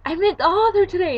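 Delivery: high, loud gleeful announcement; excited news. Retold or imitated. Daughter telling dad about meeting author, excited. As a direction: burst out with the big news.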